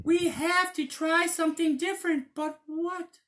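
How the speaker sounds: very high squeaky wolf voice; little wolves. Acted. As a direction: squeak it like puzzled cubs. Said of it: "they said".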